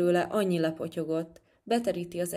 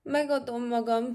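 high-pitched cry of surrender; storybook plea to stop; shouted; 5 semitones higher than usual. A narrator's reading. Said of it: "kiáltotta Luca".

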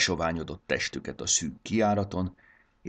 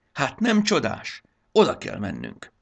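delighted; 8 semitones higher, 5 dB louder than usual. Acted. Módusz marvelling at the blossoms, delighted. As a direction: marvel at the blooming meadow.

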